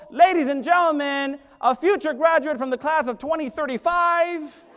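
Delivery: loud announcer calling names. Retold or imitated. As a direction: announce each kid like a DJ. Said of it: "sounds like a D J".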